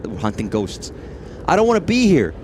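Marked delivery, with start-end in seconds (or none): 1.5-2.3 high, loud whining; whiny scared shout. Pretend. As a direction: wail it like a terrified ghost hunter. Acted ghost hunter whining.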